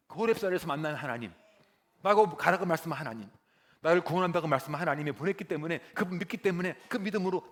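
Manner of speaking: loud, high preaching build; fervent preaching. Real, not acted. Preacher to congregation, loud fervent preaching. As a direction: preach it out with rising fire.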